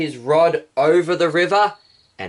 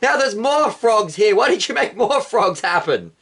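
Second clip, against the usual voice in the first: Pharaoh scolding; 7 semitones higher than usual. Acted. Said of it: "Pharaoh would have been like".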